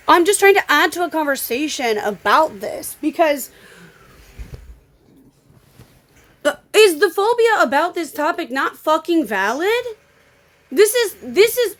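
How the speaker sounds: loud, high-pitched real anger; getting mad. Real, not acted. Streamer defending a phobia to hostile commenters, getting mad. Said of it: "I'm getting mad".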